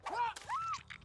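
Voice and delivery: sharp high gasp of real surprise; startled gasp. Real, not acted. Streamer startled by something in the game, sharp high gasp. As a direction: catch the breath, suddenly startled.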